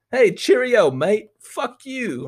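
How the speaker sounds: hammed-up British accent, pitched high; mock British listener. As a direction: play an indignant British listener, swooping high. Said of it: "have a British listener fucking".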